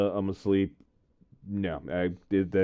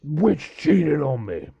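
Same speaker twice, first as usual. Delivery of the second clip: friend's voice; 5 semitones higher, much slower than usual. Retold or imitated. Friend realising, voiced slowly.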